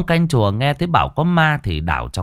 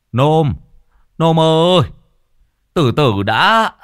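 loud, raised-pitch shout; calling after to stop; 7 semitones higher, 6 dB louder, slower than usual. A narrator's reading. Old father chasing his son to the door, shouting to stop him. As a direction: holler after someone who is leaving. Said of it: "Ông Kê đuổi ra cửa gàn".

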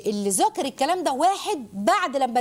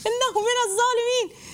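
mock outraged crowd; 12 semitones higher than usual. Idolaters repeat the accusation.

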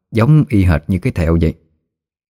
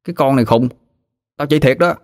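put-on higher-pitched child voice, protesting; lightly voiced boy urging a friend on; insistent boy; 6 semitones higher than usual. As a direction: insist you really mean it, like a kid.

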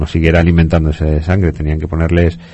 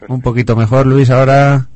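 loud into the mic; 7 semitones higher, 8 dB louder than usual. Real, not acted. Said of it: "ya te tienes que estar comiendo el micro".

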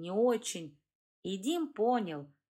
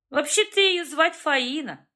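smirking correction; 7 semitones higher, 8 dB louder than usual. A narrator's reading. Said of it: "хмыкнул Добромир".

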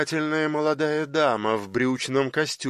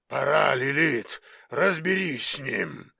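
giant hurrying her. A narrator's reading. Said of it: "поторопил её Тифон".

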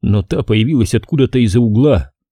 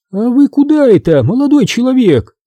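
high, raised woman's challenge; stranger calling down; 13 semitones higher, 4 dB louder than usual. A narrator's reading. Neighbour to a young intruder from an upstairs window, challenging call.

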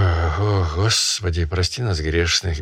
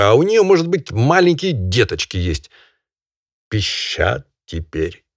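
moralizing barin; 3 semitones higher than usual. Acted. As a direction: preach at him in mock outrage.

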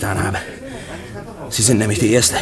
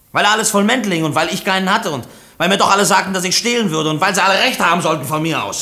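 bitter outburst; 8 semitones higher than usual. Acted. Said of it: "Brüllen Sie nicht so".

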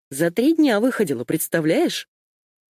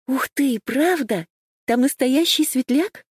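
squealing; 8 semitones higher than usual. Acted. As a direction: squeal it out, thrilled and breathless. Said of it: "Саи завизжала".

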